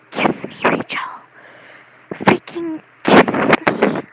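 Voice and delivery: forceful whispered mock plea; hammed-up, loud stage whisper; intense whisper. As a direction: whisper it urgently, dragging out the repeat. Re-enacting an outburst said downstairs.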